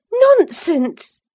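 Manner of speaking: high, loud scornful outburst; passionate cry. A narrator's reading. Catherine crying out in passion at Linton. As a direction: fling the word at him in a passion. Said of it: "cried Catherine in a passion".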